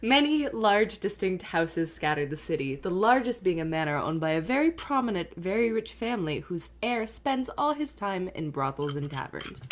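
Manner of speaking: lightly voiced scene setting; game master narration. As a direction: read it like a dungeon master.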